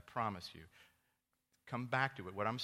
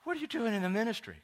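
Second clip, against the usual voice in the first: mock know-it-all; 13 semitones higher, 8 dB louder, faster than usual. Trendy friend correcting the pastor, high smug mock voice. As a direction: catch him out triumphantly. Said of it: "He goes".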